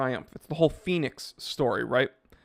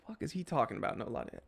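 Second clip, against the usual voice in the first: mock confused viewer; 2 semitones lower, 13 dB quieter, faster than usual. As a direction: voice the puzzled viewer under your breath. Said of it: "you're sitting there being like".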